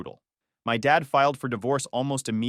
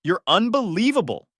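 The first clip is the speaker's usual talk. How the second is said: higher, louder contemptuous retort; spat out; 8 semitones higher, 5 dB louder than usual. Retold or imitated. Son spitting contempt at his mother, loud. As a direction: spit the words at her in disgust. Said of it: "I spat".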